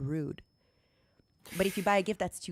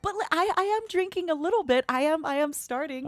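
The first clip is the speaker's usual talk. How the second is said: defensive protest; 9 semitones higher than usual. Real, not acted. Defensive, high-pitched protest.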